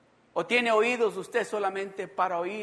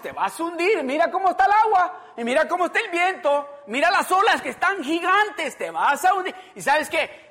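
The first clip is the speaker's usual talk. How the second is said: loud, higher-pitched urgent warning; alarmed warning; 5 semitones higher, 8 dB louder than usual. Acted. Warning Peter he will sink in the waves, alarmed.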